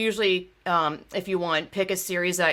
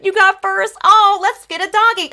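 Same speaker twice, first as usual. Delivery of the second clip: high, loud, sing-song mock of a condescending man; cooing at the girls like they're pets; mocking the organizer; 10 semitones higher, 7 dB louder than usual. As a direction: make him sound patronizing and silly. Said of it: "Being like".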